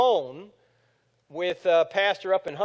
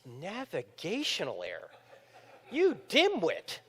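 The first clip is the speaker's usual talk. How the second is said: scoffing; 4 dB quieter, slower than usual. Retold or imitated. Mocking the CNN commentator.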